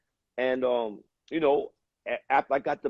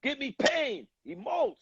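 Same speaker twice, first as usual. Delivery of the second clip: put-on high, yelled orders in a thick German accent; German director screaming at an actor in a thick accent; 12 semitones higher than usual. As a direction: bark it like a German film director. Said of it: "He was screaming in a thick German accent".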